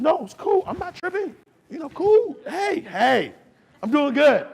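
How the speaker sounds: high-pitched, mock-casual reassurance; breezy brush-off. Acting out man brushing her off.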